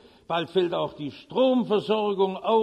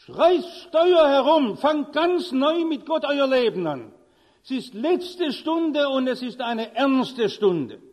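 forceful preaching; 4 semitones higher, 4 dB louder than usual. Acted. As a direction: hammer home the warning. Sermon climax, urgent appeal.

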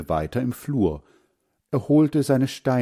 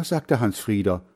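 ordinary delivery, nothing marked

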